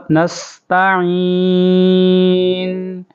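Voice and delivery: نستعین with a long hissing س; نستعین sung slowly, س sharp; whistled seen. Shown as a demonstration. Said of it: "یہ سین کی سیٹی کی آواز،".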